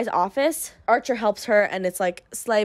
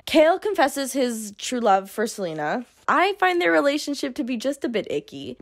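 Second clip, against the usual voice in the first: ordinary delivery, nothing marked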